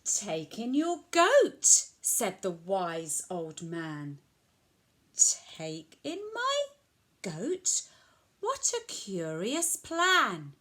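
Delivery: lilting picture-book storytelling with sweeping pitch; reading to children. A narrator's reading. Old man advises puzzled old lady, animated storytelling. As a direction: read it out clearly for a small child.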